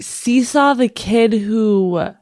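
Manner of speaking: normal talking voice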